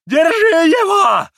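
very high, loud, drawn-out shriek; old man's frantic screech; squealing. A narrator's reading. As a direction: squeal for the thief to be seized. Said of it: "завизжал Фёдор Павлович".

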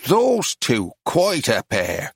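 put-on strong Irish accent, slow drawn close. A narrator's reading. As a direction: say it with a broad Irish brogue. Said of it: "in a very strong Irish accent said".

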